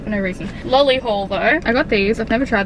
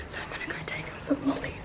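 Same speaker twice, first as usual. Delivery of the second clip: quick hushed whisper; 3 semitones higher, 13 dB quieter, much faster than usual. Real, not acted. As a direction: whisper so he won't hear. Partner busy nearby, speaks hushed.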